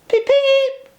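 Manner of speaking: high, loud peeping whistle; engine whistle. A narrator's reading. Edward whistling at the station, high and loud. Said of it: "whistled Edward".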